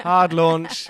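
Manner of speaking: loud, high mock announcement; teasing loud callout. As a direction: blare it out to tease your co-host.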